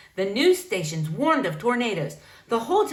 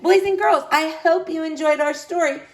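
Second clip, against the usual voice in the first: cheerful sign-off; 7 semitones higher, 4 dB louder than usual. Real, not acted. Reader addresses children.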